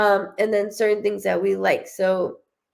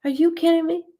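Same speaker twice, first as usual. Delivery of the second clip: excited disbelief; 7 semitones higher than usual. Retold or imitated. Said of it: "She goes".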